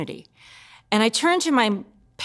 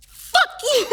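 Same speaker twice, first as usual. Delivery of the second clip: very high, strained burst of cursing; swearing outburst; 4 dB louder than usual. Acted. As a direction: let the curse burst out in acted panic. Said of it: "And so I'd be like".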